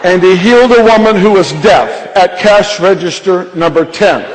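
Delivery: loud, high, drawn-out store announcement; intercom announcer. Retold or imitated. Young man tells the whole store about a healing, announcing. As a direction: proclaim it over an imagined PA, imitating an announcer. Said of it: "he takes the intercom and he goes".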